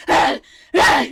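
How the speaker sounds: loud zombie shrieks; zombie screams. Acted. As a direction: shriek while smashing its head. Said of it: "this zombie's like".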